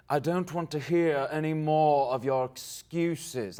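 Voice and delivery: a hint of a slow villain; slow, menacing villain line; bad guy voice. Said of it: "be, like, a bad guy".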